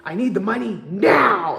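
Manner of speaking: slow, loud, harsh demand for money; mock American landlord, loud and slow. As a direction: bark it out like a pushy landlord. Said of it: "it's no".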